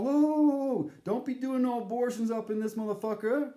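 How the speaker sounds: high, loud startled whoa; bartender reacting. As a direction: pull him up short, bartender style. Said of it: "he's like".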